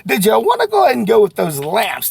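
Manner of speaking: high, loud eager salesman pitch; confident showroom pitch; bold sales pitch. Shown as a demonstration. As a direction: sell it boldly to the customer. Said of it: "You just gotta be brave and say".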